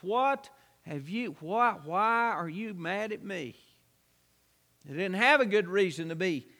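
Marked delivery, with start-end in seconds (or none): 0.0-3.5 soft, gentle plea, mildly acted; calm the angry men down softly; soft answer. Gideon answering the angry men of Ephraim, softly. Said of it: "A soft answer turneth away wrath".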